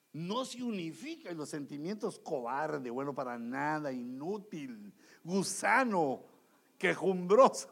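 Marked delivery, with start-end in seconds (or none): 2.2-4.9 low, contemptuous inner voice; self-abusing feelings. Acted. Feelings berate the worker who stays silent, low and scornful. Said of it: "Hasta te maltratan los sentimientos". 5.2-7.7 mock insults, getting louder; self-abusing feelings. Feelings berate the worker who stays silent, mock-scornful and loud. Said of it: "Hasta te maltratan los sentimientos".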